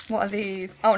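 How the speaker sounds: ordinary delivery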